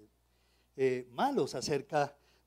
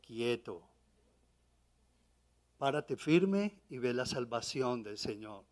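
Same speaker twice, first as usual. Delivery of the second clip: calm, steady voice of the Lord; 5 semitones lower, 5 dB quieter than usual. Retold or imitated. The Lord's calm inner voice.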